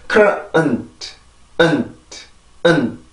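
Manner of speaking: weak unreleased final t; barely touch the t, no new air; soft final t. Shown as a demonstration. Teacher demonstrating a weak final t, softly. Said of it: "살짝 닿았다가 그냥 떨어지는 거죠".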